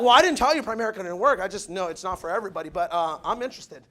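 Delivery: low, fast sheepish doubter; mock doubter turning.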